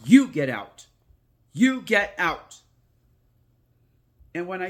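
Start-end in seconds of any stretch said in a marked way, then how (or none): 0.1-2.6 loud, then slower and higher on the repeat; firm banishing shout. Shown as a demonstration. Grandma showing her grandson how to order a ghost out, loud and firm. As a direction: order the ghost out like you mean it.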